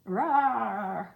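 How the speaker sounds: loud mock monster roar, comic; a loud cartoonish rawr. A narrator's reading. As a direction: roar it out like a sulking beast. Johnny's outburst.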